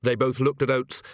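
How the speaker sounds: usual speaking voice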